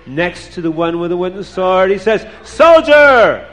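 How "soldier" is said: high, loud Tsar's shout; angry scream. A narrator's reading. Tsar inspecting the troops screams at a soldier, angry shout. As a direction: scream it at the soldier like a furious commander. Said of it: "the Tsar starts to scream at the soldier".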